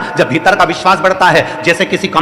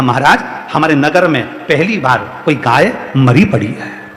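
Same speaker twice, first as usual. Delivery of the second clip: grave report; 4 semitones lower than usual. Acted. Woman reports dead cow, first take. (धीमे, भारी सुर में ठहरकर खबर)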